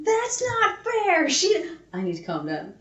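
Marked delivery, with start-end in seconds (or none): 0.0-1.7 high, very slow whine; high drawn-out sulky whine; whiny audio voice. Retold or imitated. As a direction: whinge it like a sulky child. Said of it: "that audio was like".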